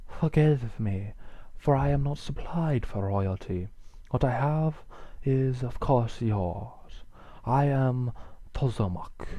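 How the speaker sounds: put-on slow Central American-accented basilisk; humble, unhurried words in a Central American accent. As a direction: Central American old reptile, halting. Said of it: "going for the Central American".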